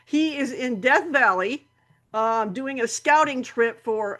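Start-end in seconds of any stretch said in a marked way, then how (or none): none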